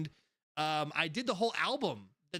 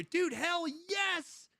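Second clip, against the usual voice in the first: excited; 11 semitones higher, much slower than usual. Real, not acted. Fan greeting, excited.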